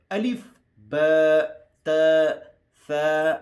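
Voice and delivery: الف، با، تا، ثا spelled out slowly, each letter loud and drawn out; slow letter demo. Shown as a demonstration. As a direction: sound out الف، با، تا، ثا one by one, clearly. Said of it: "زبان کا سر اوپر داندوں کے ساتھ". (حروف کو آہستہ، بلند اور واضح ادا کریں)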